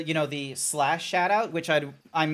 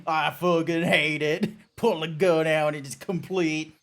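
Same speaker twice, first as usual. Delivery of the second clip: put-on putting on the other person's drawl accent; imitated drawl accent. Teasing Grant by copying his accent, mock drawl. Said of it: "thinking of these in your accent".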